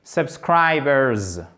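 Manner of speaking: Subscribers, very slow, ending in a buzzing Z; final S voiced. Shown as a demonstration. Teacher shows the final S, slow Subscribers ending in Z. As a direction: stretch Subscribers and finish on a z sound. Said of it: "C'est un the".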